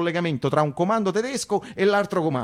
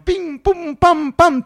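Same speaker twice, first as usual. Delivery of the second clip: high bright pops, the last ones louder; gleeful bang sounds; popping noises; 13 semitones higher, 4 dB louder, much slower than usual. Retold or imitated. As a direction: mimic the tyres bursting one after another. Nails bursting tires like a symphony.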